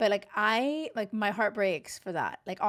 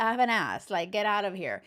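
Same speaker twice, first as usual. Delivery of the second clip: ordinary talk, nothing marked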